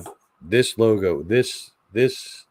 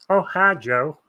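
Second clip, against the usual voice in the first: cartoon impression; 7 semitones higher, 3 dB louder, slower than usual. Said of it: "my impression of him".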